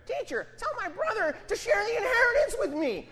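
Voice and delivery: hammed-up whiny voice from the crowd; whiny little voice. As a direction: whine it like a petty, nagging brother. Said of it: "this whiny little voice over here".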